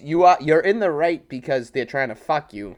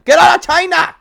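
much higher and louder, a blasting yell; mock yell; 14 semitones higher, 11 dB louder than usual. Threatening the dealers for a laugh, a booming mock yell. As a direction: roar it like running them out of town.

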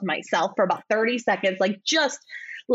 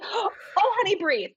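soothing attendant; 10 semitones higher than usual. Retold or imitated. Voicing the comforting flight attendant.

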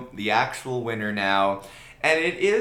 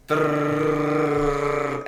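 rolled r drumroll, pitched up; rolled-tongue drumroll; 4 semitones higher, 5 dB louder than usual. Pretend. Mock drumroll before winner.

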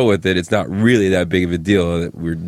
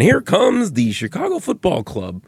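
put-on high old-time newsreel announcer; crackly vintage sportscaster voice; old timer voice; 9 semitones higher than usual. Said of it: "the old, old timer voice".

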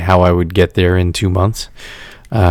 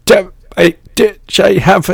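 choppy voice; 11 semitones higher than usual. Pretend. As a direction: fake broken-up connection voice. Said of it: "I don't do choppy voice well".

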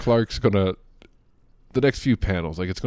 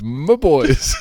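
put-on louder, laughing "my boys"; doting mom voice; 5 semitones higher, 8 dB louder than usual. Shown as a demonstration. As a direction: coo "boys" fondly. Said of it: "She says".

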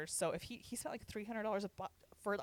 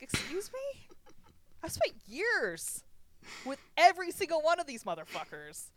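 mock indignant rant; 5 semitones higher, 4 dB louder than usual. Indignant retold rant at friend.

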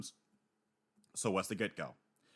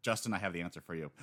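usual speaking voice

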